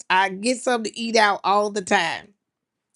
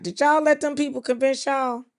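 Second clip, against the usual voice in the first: ordinary talk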